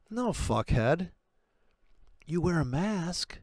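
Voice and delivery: hammed-up sanctimonious manager; mock boss rant. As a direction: sneer out the boss's green slogans. Mocking a boss's mask rule.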